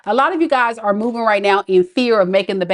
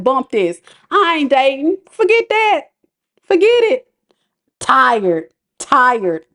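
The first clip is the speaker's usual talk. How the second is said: fed-up dismissal; 3 semitones higher than usual. Retold or imitated. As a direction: wave it all off, exasperated. Voicing fed-up Aquarius.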